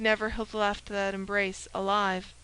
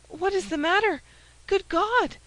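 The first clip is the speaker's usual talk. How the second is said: a higher, startled cry; alarmed question; 9 semitones higher, 4 dB louder than usual. Acted. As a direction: ask in sudden alarm. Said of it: "asked the poor woman".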